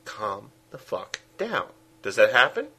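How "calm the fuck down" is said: slow, much softer urgent hush; hushed urgent order. Retold or imitated. Cameraman silencing the heroine, slow, much softer urgent hush. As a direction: hush her hard and slowly. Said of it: "putting his hand over her mouth to say".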